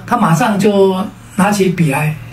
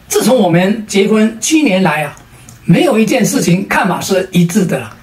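usual speaking voice

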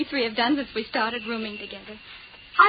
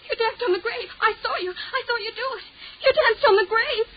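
high, rising hysterical cries; 6 semitones higher than usual. Acted. Hysterical repetition.

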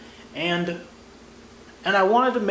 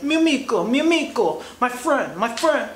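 high, sing-song Italian street hawker calling out; mock Italian vendor hustling a tourist; a hammed-up Italian hawker's friendly call; 6 semitones higher than usual. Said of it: "They come up to you on the street like".

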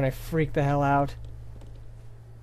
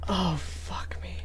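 drawn-out hushed curse; slow whisper; 2 semitones higher, 5 dB quieter, much slower than usual. Real, not acted. Scared gamer hiding, slow whispered curse. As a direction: breathe it out in dread.

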